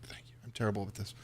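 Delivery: whispered aside to oneself; near-whispered self-scolding; self-reproachful mutter. Real, not acted. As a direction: mutter it under the breath, barely voiced. Flustered GM berating self.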